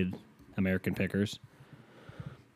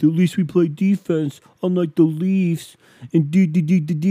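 mocking Islanders fan; 8 semitones higher, 6 dB louder than usual. Host mocking Islanders fans who defend their team, smug taunt. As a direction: mimic a smug fan talking back. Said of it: "before you come at me with".

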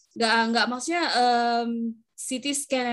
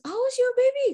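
much higher acted question; high, lifted mock inquiry; retold eager question; 13 semitones higher, 4 dB louder than usual. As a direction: act out engaging a patient, pitched right up. Retold question to engage patient.

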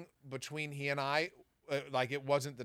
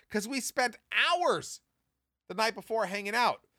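normal talking voice

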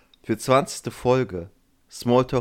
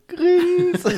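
squeaky laugh; 6 dB louder than usual. Pretend. Mimicking own grin.

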